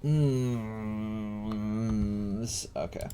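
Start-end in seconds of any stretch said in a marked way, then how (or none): none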